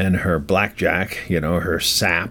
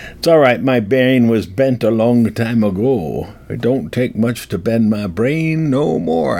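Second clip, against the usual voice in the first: put-on Old West accent; 2 semitones higher than usual. Bent-brained old-timer reassuring listeners, Old West accent. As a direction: talk like an old cowboy-movie Old West character. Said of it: "The Old West accent".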